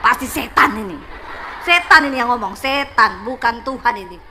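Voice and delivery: low, loud rebuke of the devil; acted-out stern exorcising command; retold rebuke. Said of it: "Langsung saya tengking itu suara".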